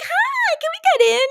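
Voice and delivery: very high, sing-song hi, can we get in. Retold or imitated. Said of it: "we'd be like".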